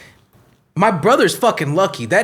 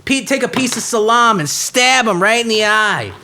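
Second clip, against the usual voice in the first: higher, fired-up revenge tirade; worked-up accented rant; 4 semitones higher than usual. Acted. Cop character urging revenge on the dog stabber, worked up. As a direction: rant heatedly in a put-on accent. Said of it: "these accents are hard to do".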